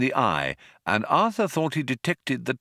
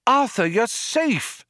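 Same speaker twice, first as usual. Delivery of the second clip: high, drawn-out cry; relieved cry. A narrator's reading. A voice greets Arthur on his arrival, high relieved cry. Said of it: "a voice cried".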